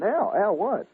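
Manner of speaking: high, baffled question; surprised. Retold or imitated. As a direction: sound thrown by the name. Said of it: "I said".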